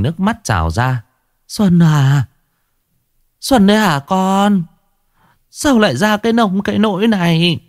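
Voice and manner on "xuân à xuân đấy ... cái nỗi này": high, pained cry of shock; distraught old grandmother. A narrator's reading. Grandmother finding Xuân tied in the cellar, high anguished voice. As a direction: call out to her lost grandchild in dismay.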